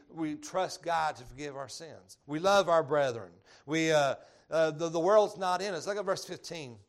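ordinary delivery

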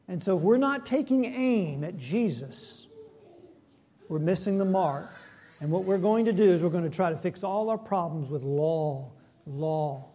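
ordinary talk

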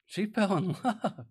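delighted repeat with a real laugh. Real, not acted. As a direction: laugh through the last word. Preacher laughs while repeating the line.

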